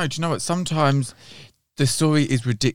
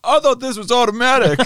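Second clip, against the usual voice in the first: mock whiny complaint; 12 semitones higher, 4 dB louder than usual. Jokey car joke voice.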